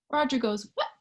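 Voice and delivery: plain reading voice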